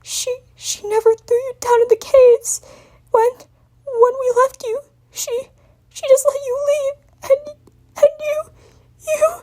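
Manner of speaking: high trembling sobbing stammer; creaking, choked voice. Acted. Spike confessing his nightmare, voice creaking with sobs. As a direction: let the throat close as you cry it out. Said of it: "voice was creaking, throat tighten".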